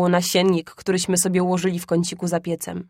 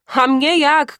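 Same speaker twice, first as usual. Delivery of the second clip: higher and louder, pushing in; cheeky shout; 7 semitones higher, 4 dB louder, slower than usual. A narrator's reading. Felek shouting out to father from under the coat, eager. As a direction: shout to be noticed. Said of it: "krzyknął Felek".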